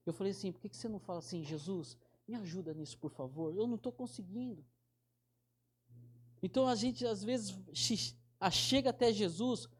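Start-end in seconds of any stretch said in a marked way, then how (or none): 0.5-4.6 softly, almost under the breath; gentle prayer. Retold or imitated. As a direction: hush it like a child's honest prayer. Teaching a child a gentle prayer.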